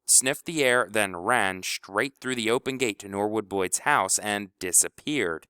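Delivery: ordinary delivery, nothing marked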